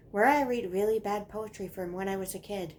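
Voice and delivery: normal talking voice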